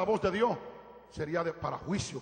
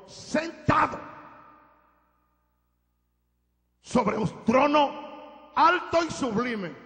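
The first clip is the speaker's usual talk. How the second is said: slow, drawn-out words climbing high and loud; exalted proclamation; 8 semitones higher, 6 dB louder, much slower than usual. Real, not acted. Preacher unveils the Lord on the throne, exalted proclamation. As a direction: ring out each word of the vision.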